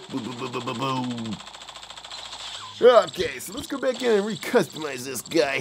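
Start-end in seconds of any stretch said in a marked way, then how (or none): 0.1-1.4 pitched well down, quiet mouth explosions; low, much softer boom sound effects. Pretend. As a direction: murmur the booms like distant cannon fire. Sound effects for game shots.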